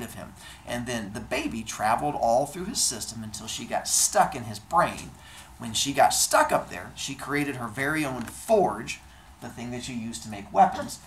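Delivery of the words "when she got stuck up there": plain reading voice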